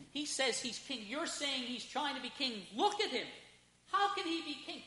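high, hushed incredulous plea; Pilate. Acted. Pilate arguing Jesus cannot be king, high hushed plea.

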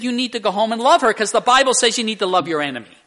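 loud, rapid retold command; acted emphatic counsel. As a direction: play a counselor hammering the punchline home. Acted counselor delivering the punchline advice.

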